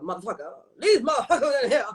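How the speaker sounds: fast, brassy diva outburst with a laugh; Jennifer Lewis impression. Impersonating Jennifer Lewis swearing about the people in here. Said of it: "I think I do a Jennifer Lewis very well".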